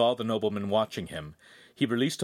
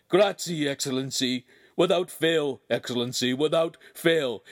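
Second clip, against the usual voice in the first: dazed repeating; 5 semitones higher, 4 dB louder than usual. A narrator's reading. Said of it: "he kept repeating".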